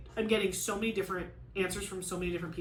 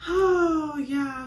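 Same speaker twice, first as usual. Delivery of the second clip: tearful; 8 semitones higher, 9 dB louder than usual. Real, not acted. Tearful outburst mid-vlog.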